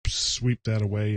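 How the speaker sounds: ordinary talk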